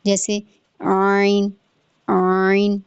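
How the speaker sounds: عین squeezed in the middle throat, pushed inward; عین with a tight inward squeeze; squeezed ain. Shown as a demonstration. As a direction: wring the sound like cloth for عین. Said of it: "اندر کی طرف آواز کو push کرنا".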